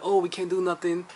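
high, sing-song fake apology; lightly voiced coy refusal; mock regret. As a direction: pretend you're sorry it can't happen. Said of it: "you're like".

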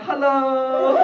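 high, loud, held-out hello; hammed-up sheepish greeting; awkward mock greeting. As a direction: stretch out a comic hello on a high note. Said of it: "we're like".

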